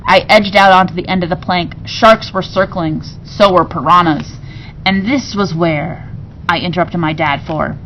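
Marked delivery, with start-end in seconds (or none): none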